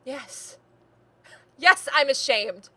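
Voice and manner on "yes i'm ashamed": loud, raised-pitch indignation; impassioned outburst. Acted. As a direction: let the shame pour out as a loud tirade. Acted quarrel, niece's angry outburst.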